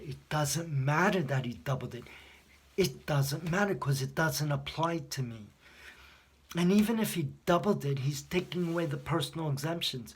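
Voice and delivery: about to blow up, hammering each point; near-screaming anger. Retold or imitated. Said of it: "I was ready to freaking scream at her".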